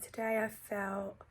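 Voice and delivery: ordinary talk, nothing marked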